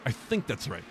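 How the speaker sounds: normal talking voice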